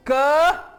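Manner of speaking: hard क that won't blow out the flame; क without aspiration, no puff of air; plain k demo. Shown as a demonstration. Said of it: "क कहने से lighter नहीं बुझेगा".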